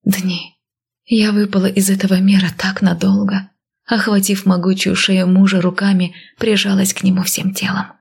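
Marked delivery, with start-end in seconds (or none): none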